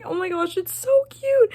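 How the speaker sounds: high squeal of delight on so; gushing squeal. Real, not acted. Reader gushing about two characters, squealing. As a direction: squeal over how cute it is. Gushing over book friendship.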